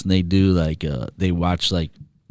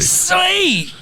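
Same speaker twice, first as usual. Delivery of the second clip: excited squeal. Real, not acted. Excited reaction to a story.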